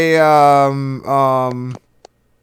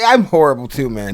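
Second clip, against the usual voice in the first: ordinary talk, nothing marked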